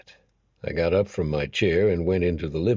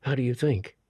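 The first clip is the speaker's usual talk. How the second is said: gentle, loving; 7 semitones higher than usual. A narrator's reading. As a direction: ask it lovingly, higher. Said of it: "asked in a gentle, loving tone".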